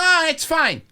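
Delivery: high, loud panicky brush-off; mock dismissive officials. Officials dodging a look at the books, mock-dismissive. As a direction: wave it away too loudly. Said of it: "And everyone's like".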